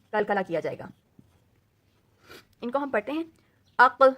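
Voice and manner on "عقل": عقل with a thick back-throat ق and a bounce at the end; heavy qaf. Shown as a demonstration. Teacher showing the heavy letter to students, loud. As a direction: sound عقل heavy, echoing the stop. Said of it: "ہم موٹا پڑھیں گے".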